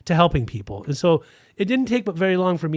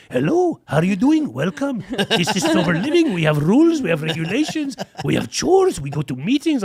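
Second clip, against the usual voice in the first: put-on high, swooping Persian-accented Yoda voice; 4 semitones higher than usual. Recovery home owner greets a newcomer at his door, hammed-up Persian accent. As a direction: imitate a little Persian Yoda with singsong jumps in pitch. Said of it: "answered it with a Persian accent".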